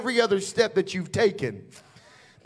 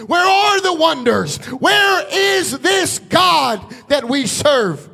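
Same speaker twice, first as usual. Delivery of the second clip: rising preacher's cry, louder and higher, slowing at the end; preaching at full force; 4 semitones higher, 4 dB louder than usual. Acted. Preacher challenging the crowd about God, in a full shouting cry. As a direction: thunder each question at the congregation.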